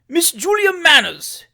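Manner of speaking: high, loud, drawn-out outburst of surprise; the mayor's loud, astonished cry; astonished exclamation. A narrator's reading. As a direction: exclaim the name slowly, aghast. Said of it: "exclaimed the mayor at length".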